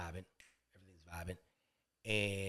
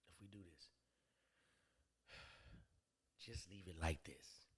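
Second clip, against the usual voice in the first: hushed proposition; 10 semitones lower, 22 dB quieter than usual. Retold or imitated. Woman laying out terms to the man, hushed proposition.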